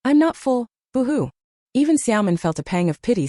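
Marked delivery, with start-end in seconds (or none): 0.0-1.3 put-on high, whimpering little girl; crying baby voice. A narrator's reading. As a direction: sniffle it out like a hungry toddler. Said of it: "sniffle and cry softly ... using her baby voice".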